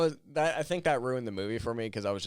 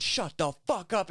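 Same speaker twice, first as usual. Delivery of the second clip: high-pitched mock-angry retort; imitated angry comeback, pitched well up; retold angry outburst; 12 semitones higher, 3 dB louder than usual. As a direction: act out a drunk friend lashing out. Retold drunk friend snapping back.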